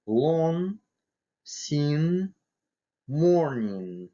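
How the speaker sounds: "lawn, sin, morning" each ending in a held ng; ng sound. Shown as a demonstration. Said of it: "Похожий звук есть в английском языке". (носовое ng на конце «lawn, sin, morning»)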